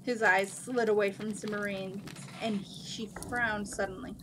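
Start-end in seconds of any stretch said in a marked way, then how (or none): none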